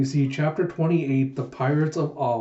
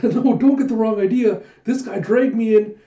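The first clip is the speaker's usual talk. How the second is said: high, loud, panicky excuse; flustered protest; 8 semitones higher, 6 dB louder than usual. Acted. Huck caught among beautiful women, flustered protest. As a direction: protest innocence, much higher and louder.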